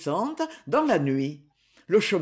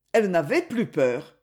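ordinary delivery

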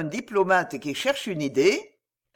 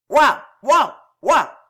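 three loud, high barks; dog barking; 6 semitones higher, 9 dB louder, slower than usual. A narrator's reading. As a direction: bark "woof" like a dog. Barks punctuate the minister's speech.

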